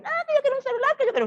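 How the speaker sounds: high-pitched mock whining; squeaky, exaggerated pleading; whiny demanding child. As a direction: imitate a spoiled kid begging. Mocks child demanding a cell phone.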